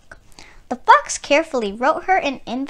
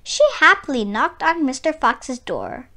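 usual speaking voice

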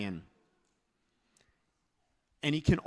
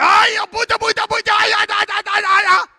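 high, shouted rapid gibberish chant; warrior's war cry; 17 dB louder than usual. Acted. Preacher acts the scared warrior standing his ground, yelling. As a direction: yell in brave panic at the beast. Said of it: "So he stands there".

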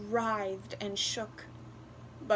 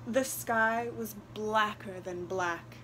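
ordinary delivery

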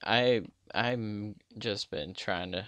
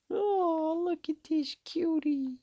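put-on very high baby-talk squeal; tiny high-pitched gushing voice; squeaky cooing; slower than usual. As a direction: coo over something adorable in falsetto. Cooing at a cute player.

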